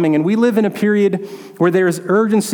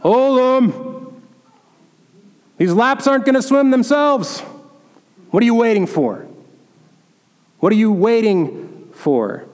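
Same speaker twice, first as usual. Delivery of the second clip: higher, urging gym coach calling across the pool; coach's voice; 4 semitones higher than usual. Retold or imitated. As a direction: bark it like a gruff coach at the pool edge. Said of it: "I can hear Coach Sullivan's voice".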